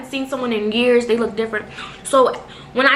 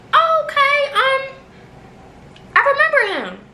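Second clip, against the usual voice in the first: squeaky surprised okay; very high bright reply. Retold or imitated. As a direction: chirp it up really high. Acting her reply at the door.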